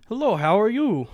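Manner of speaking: soft mock Russian woman; thick Russian accent. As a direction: retell her greeting in a Russian accent. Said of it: "she has, like, a thick Russian accent".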